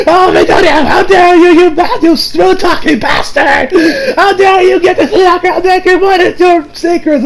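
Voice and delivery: high-pitched outraged mock rant; hammed-up indignant yelling. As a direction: imitate a pair chewing out their friend, voice pushed way up. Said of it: "start just yelling at him".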